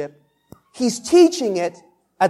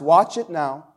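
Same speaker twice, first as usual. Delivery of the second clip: ordinary talk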